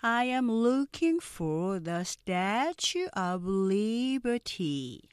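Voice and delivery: very slow, every word stretched; drawn-out, deliberate English demo; slow-speed model. Shown as a demonstration. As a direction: model the sentence slowly for learners to repeat. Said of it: "먼저 느린 속도 발음 듣고".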